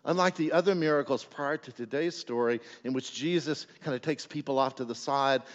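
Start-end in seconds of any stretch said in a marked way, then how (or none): none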